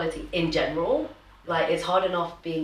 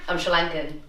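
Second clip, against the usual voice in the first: normal talking voice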